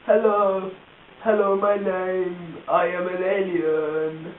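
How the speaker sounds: slow, stretched-out silly alien; funny alien voice. Acted. Demonstrating the sketch's alien, funny drawn-out voice. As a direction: drone each word out long like a daft alien. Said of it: "he's got a really funny voice".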